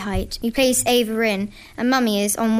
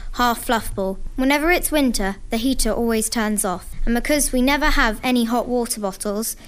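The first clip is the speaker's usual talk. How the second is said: usual speaking voice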